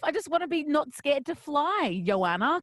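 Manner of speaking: high, hammed-up panicky protest; mock-beg in a squeaky voice; panicked pleading. Host retelling refusing her hypnotist, hammed-up high panic. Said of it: "I'm like".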